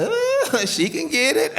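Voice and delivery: high squealing ooh of admiration; admiring ooh. Retold or imitated. Husband admiring his coaching wife, squealing ooh. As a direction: swoon at her like a fan.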